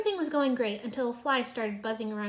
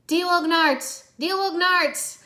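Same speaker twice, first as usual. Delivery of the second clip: high, loud, slow frantic repeats; frantic shout; 7 semitones higher, 9 dB louder, much slower than usual. A narrator's reading. Zane shouts frantically to warn Cole, loud. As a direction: shout it desperately twice. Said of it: "Zane shouted frantically".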